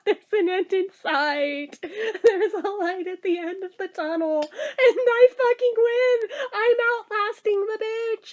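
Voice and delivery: high, choked-up victory; teary triumph. Real, not acted. Gloating to viewers about outlasting the manager, teary. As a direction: crow in triumph through tears.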